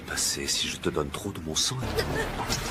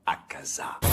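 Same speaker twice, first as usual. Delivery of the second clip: cold whisper; 3 semitones lower than usual. Acted. Master coldly names a subordinate.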